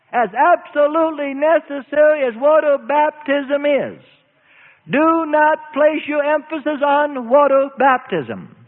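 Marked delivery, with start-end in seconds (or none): none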